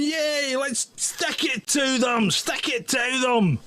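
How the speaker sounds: a hint of a German accent, slow and cheering; mock German cheer, slow and drawn out. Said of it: "In a German accent, of course".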